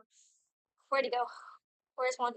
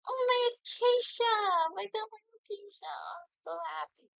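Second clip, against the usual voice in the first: excited squeal; 8 semitones higher than usual. Real, not acted. Streamer squealing over her new Acacia, giddy. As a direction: gush over the prize flower, much higher.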